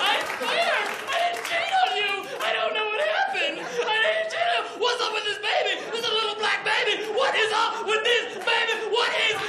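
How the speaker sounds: high, frantic accusing cries; mock outrage. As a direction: play the betrayed spouse in a high-pitched fit. Acted row with wife over baby.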